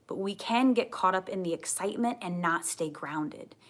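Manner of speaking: usual speaking voice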